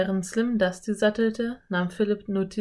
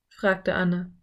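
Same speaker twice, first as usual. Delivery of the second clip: ordinary delivery, nothing marked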